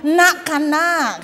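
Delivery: high, loud Madurese-accented call. Shown as a demonstration. Showing how Madurese call a child, Madurese accent. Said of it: "Itu logatnya Madura. Kalau manggil anak".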